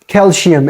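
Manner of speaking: "calcium" with a sh sound for the c; "calcium" said like "calshium"; mispronounced calcium. Shown as a demonstration. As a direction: say "calcium" as kel-shee-um. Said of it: "should not be vocalized like".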